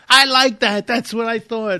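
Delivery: high, loud mock voice of the kid; hammed-up young fan voice; quoting the young fella. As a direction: squeal it like an excited young guy. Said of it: "And he guy goes".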